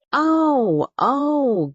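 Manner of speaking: high, long astonished groan of a crowd; a crowd of villagers groans in astonishment at the strangers. A narrator's reading.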